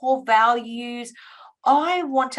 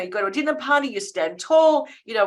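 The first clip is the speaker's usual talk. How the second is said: ordinary talk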